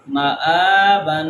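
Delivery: مآبن with the first a held long. Shown as a demonstration. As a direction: stretch the a of مآبن for one alif. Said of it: "کھڑا زبر ہے تو ہم اس کو لمبا کریں گے".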